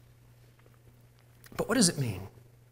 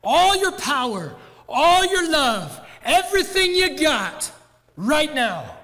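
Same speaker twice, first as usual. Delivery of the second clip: slow, much higher, loud corner shout; trainer's yell; 9 semitones higher, 6 dB louder, slower than usual. Retold or imitated. Boxing trainer to Rocky in the corner, loud urging yell. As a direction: push the fighter for one last round. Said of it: "and he says".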